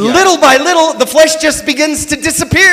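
rising fervent sermon voice; preacher's voice. Real, not acted. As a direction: preach it with building fire. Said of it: "now I have a preacher's voice".

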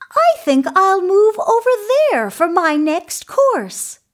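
very high, quick little goat voice; small creature's high-pitched voice. A narrator's reading. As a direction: pipe up as a tiny goat, loud and high. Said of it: "the little Billy Goat Gruff".

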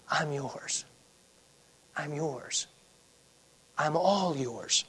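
hushed, unhurried devotion; quiet, tender yielding to God; surrendering prayer. Acted. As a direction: offer it softly, with long pauses. Voices Jacob surrendering to God.

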